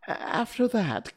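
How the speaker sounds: high, slow resumption; drawn-out, high-pitched testimony; nervous Hatter. A narrator's reading. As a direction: pick up your tale in a high, hesitant voice.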